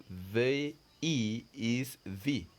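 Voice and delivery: V E is V, each letter drawn out slowly; slow spelling demo. Shown as a demonstration.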